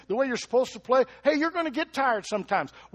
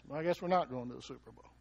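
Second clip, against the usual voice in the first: lower, softer, quick letdown; deflated fan; 7 semitones lower, 8 dB quieter, faster than usual. Retold or imitated. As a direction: let the air out of the balloon. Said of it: "Then we got another fax".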